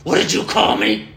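threatening whoever insulted him; menacing. Retold or imitated. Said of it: "fear turns into anger".

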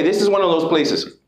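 ordinary delivery

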